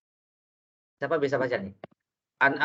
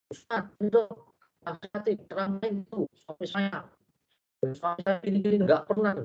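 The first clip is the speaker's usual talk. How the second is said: lightly voiced wife, higher, pleading to the ustaz; a hint of a wife complaining about her husband; wife telling on her husband to the preacher, mildly higher and pleading; 5 semitones higher than usual.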